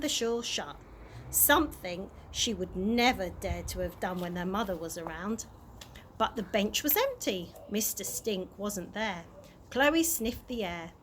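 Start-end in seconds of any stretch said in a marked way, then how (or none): none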